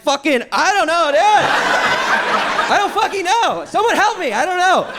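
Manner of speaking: high, drawn-out panicked whine; panicked babbling. Retold or imitated. Lost on the dance floor, panicking out loud. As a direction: flail for words in a mock panic. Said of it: "I panicked, bro".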